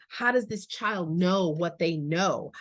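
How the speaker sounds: ordinary talk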